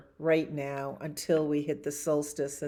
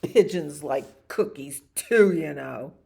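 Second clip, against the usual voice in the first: loud pigeon protest; 8 dB louder than usual. Acted.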